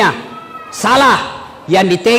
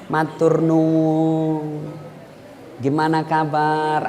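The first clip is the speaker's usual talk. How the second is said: slow, soft, syrupy politeness; girl cooing politely to her boyfriend; mock sweet politeness; 5 semitones lower, 7 dB quieter, much slower than usual.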